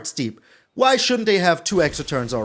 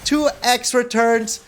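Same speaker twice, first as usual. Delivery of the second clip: gloating; 5 semitones higher than usual. Real, not acted. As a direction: rub the win in their face. Gloating at defeated opponent.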